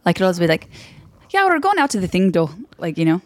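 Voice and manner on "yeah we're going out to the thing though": high, lilting mock Irish; imitated Irish accent. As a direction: put on a high-pitched Irish lilt. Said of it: "I like Irish accents".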